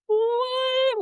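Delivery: very high, drawn-out mock what; stunned disbelief. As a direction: imitate being utterly baffled, way up in pitch. Stunned by crying father-in-law.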